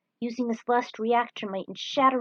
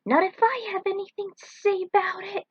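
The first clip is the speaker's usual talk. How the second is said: much higher put-on rival voice; competitive retort; 10 semitones higher, 4 dB louder than usual. Jack's rival racer answering the dare, high and taunting. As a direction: tease the rival with a playful boast.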